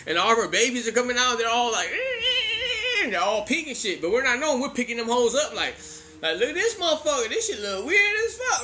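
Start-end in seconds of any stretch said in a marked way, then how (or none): 1.9-3.0 high, stretched-out little squeals; comic high-pitched squeaking; imitated baby-hamster squeaks. Imitates squeaking newborn hamster babies. 6.4-8.6 high-pitched mock wonder; amused disbelief, pitched well up; retold amazement. Kids marvel at odd babies.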